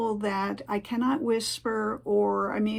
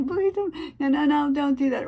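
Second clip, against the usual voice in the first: higher, louder laughing plea; pleading protest; 6 semitones higher, 4 dB louder than usual. Acted. Hearing-impaired listener stopping a whispering caller, pleading. Acted plea to quiet talkers.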